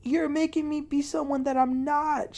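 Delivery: high-pitched, pleading retold upset; mock hysterical pleading, pitched high; imitated hysterics. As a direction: imitate someone crying out in desperate protest. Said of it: "She was, like, very, very, very ... hysterical".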